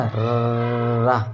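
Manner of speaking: रा rolled so the teeth buzz; trilled ra. Shown as a demonstration. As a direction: let the tongue shake on रा. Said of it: "दांतों में जो झंझनाहट होती है". (झंझनाहट के साथ रा)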